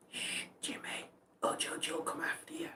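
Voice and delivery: hushed aside to the pet; whisper. Real, not acted. Scene: hushing Jimmy off to the side, whispered. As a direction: whisper it so nobody hears.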